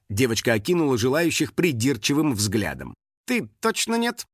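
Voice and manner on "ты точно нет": high-pitched curt rejection; dismissive. A narrator's reading. Assistant rejecting boy in glasses, dismissive. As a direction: brush him off in a high voice. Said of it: "отмахнулась она".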